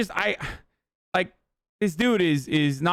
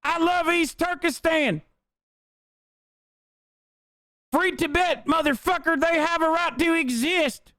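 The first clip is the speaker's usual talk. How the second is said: very high, slow parody voice; mocking fake activist; 13 semitones higher than usual. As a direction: preach it in a silly high whine. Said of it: "the other thing that they say is".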